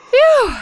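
big, high, loud puff of breath; loud worn-out sigh of relief; mock exhausted phew. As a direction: blow out like you're worn out. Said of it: "I'm exhausted just listening".

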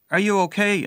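called out loud and high; half shout. Retold or imitated. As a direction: call out to him across the drive. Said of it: "I half shouted to him".

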